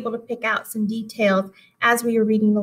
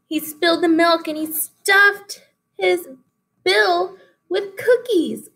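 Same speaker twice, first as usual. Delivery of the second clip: amused; 8 semitones higher than usual. Real, not acted. Teacher mocks pelican's greed.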